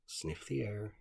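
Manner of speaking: ordinary delivery, nothing marked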